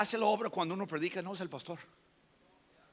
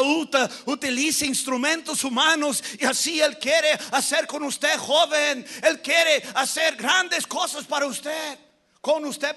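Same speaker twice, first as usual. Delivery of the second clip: raised, driving pulpit voice; full-force preaching; 5 semitones higher, 7 dB louder than usual. Real, not acted. Preacher tells youth God wants to use them, full-force preaching. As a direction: proclaim it to the whole congregation.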